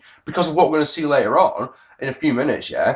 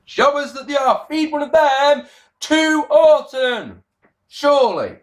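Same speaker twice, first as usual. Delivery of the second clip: high, drawn-out loud wail; exasperated high-pitched bellow; exasperated cry; 13 semitones higher, 4 dB louder, slower than usual. Real, not acted. Exasperated rant about jobbers.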